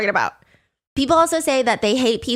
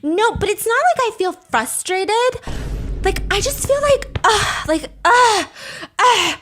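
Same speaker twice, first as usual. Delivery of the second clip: higher, slow, stammering mock whine; flustered whine; 9 semitones higher than usual. Mocking the flustered guy, high and slow. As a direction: sputter like you can't find words.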